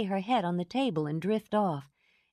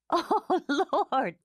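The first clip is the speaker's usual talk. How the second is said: wild laugh; 10 semitones higher, 4 dB louder than usual. A narrator's reading. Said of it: "let out a long, wild laugh".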